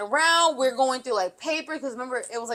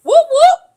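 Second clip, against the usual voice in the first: high loud whoop whoop siren; police siren imitation; 12 dB louder than usual. Police arriving, loud imitated siren. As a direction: belt out the siren, much higher and louder. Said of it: "On the loudspeaker".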